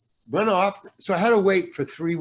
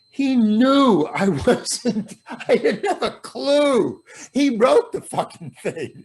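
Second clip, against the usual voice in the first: laughing through the words, higher; 6 semitones higher than usual. Real, not acted. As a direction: giggle out the punchline. Laughing while telling punchline.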